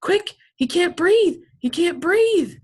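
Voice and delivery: panicked shout. Acted. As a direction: yell for the nurse in panic. Boy running for the nurse.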